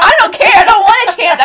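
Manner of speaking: high, loud playful objection; laughing protest. Acted. Reassuring chatty friend she wants no turn, laughing protest. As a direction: protest loudly through a laugh.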